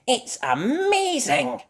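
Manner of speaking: very high, slow, marvelling; delighted Mandy. Acted. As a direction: savour how amazing it is. Mandy raves.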